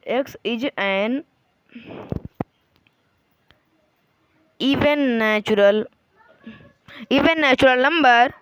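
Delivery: words spaced out with long pauses while writing; slow dictation. Shown as a demonstration. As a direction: dictate each word slowly as you write it on the board. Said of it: "बस आपको ऐसे, ऐसे कर देना है".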